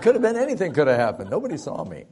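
high, loud, scrambling alibi; mock panicked excuses. Boy lying to his dad, mock panicky excuses. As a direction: babble an excuse like a cornered kid.

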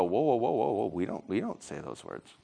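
soft, low "whoa" dropping away; alarmed low protest. Acted. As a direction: back off and object under your breath. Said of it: "and you go".

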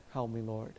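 as if praying silently in your head; hushed private prayer to himself; inner voice. Retold or imitated. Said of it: "In my mind".

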